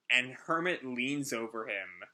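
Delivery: ordinary talk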